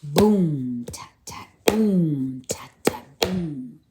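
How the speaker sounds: drum imitation. Drum fading.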